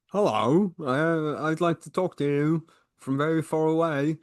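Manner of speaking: high, stiff mock formal voice; pompous old-fashioned gentleman; stuffy letter voice. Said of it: "I don't think he talked like that".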